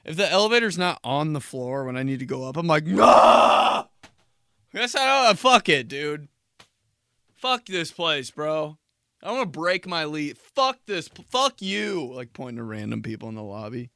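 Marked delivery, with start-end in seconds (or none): 2.9-3.9 loud, high despairing no; frustrated yell. Retold or imitated. Elevator not there. 4.7-12.1 mock rant. Ranting at lobby.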